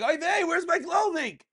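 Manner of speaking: put-on high, kvetchy Yiddish-accented whine. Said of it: "a dog walking around saying".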